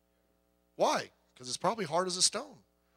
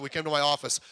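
ordinary delivery

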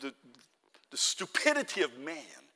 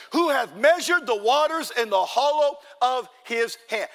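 raised, loud verse reading; forceful scripture preaching; 6 semitones higher, 7 dB louder than usual. Acted. Preacher reads verse twelve to the church, forceful proclamation. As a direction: proclaim the verse with weight on key words.

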